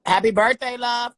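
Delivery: high, loud, gushing cheer; birthday greeting. Real, not acted. Guest wishing a fan happy birthday, excited and loud. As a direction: sing out the good wishes.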